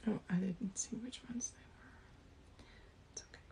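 whispering. Real, not acted. Quiet aside while other talks.

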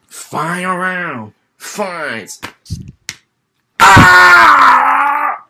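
booming fighting game announcer. Pretend.

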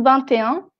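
"vingt et un" with T linked into "et"; sounded T. Shown as a demonstration. Teacher confirms rule in Spanish, liaison T in "vingt et un". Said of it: "siempre va a sonar la T".

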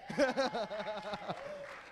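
hearty laugh after the punchline. Real, not acted. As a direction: break into a long laugh. Preacher laughs at his punchline.

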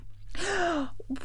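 high, quiet gasp; witch's gasp. A narrator's reading. Witch melting away.

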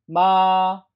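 MA held high, level and loud; first tone. Shown as a demonstration. Teacher models tone one for students, high level 마. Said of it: "한 번 더".

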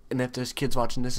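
ordinary talk

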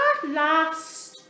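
high, loud, laughing little penguin; Dudley, fed up. A narrator's reading. Dudley complains to his family, high and loud. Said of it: "said Dudley".

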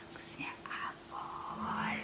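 hushed slow secret; whispering. Real, not acted.

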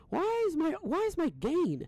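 high, drawn-out complaint; exasperated questioning. Real, not acted. Streamer puzzled by the gain setting, high exasperated complaint.

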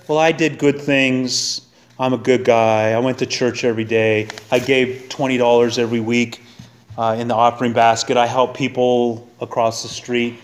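lightly voiced do-gooder in a low, even drone; a hint of someone reciting their good deeds; low mock plea of self-justification; self-justifying churchgoer.

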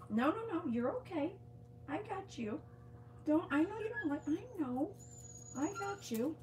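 high, soft reassuring coo; soothing dog talk. Real, not acted. Owner holding Saki for her nails, high reassuring coo. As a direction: pitch up and soothe a squirming dog. Calming dog during nail trim.

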